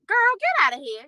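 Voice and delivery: a sharp, high dismissal; dismissive. Retold or imitated. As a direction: wave her off with a sharp dismissal. Marge dismissing Jennifer.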